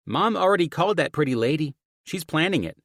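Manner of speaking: put-on high, loud child voice; young daughter. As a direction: tell Dad in a bright-eyed kid's high voice. Daughter reveals mom's plan.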